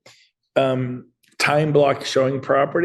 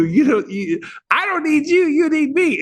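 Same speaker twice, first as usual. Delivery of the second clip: triumphant retort. Retold or imitated. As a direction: throw it back at her like a victory. Retold triumphant reply to rude client.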